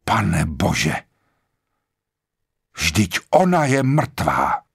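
restrained cry of shock, drawn out at the end; shocked exclamation. A narrator's reading. Blor finds the woman dead, a restrained shocked cry. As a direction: exclaim in shock without really shouting. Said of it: "Blor vykřikl".

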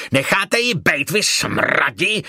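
angry man's roar, pitched high, dragged out at the end. A narrator's reading. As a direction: roar at them to back off. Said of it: "zařval muž". (výhrůžný mužský řev)

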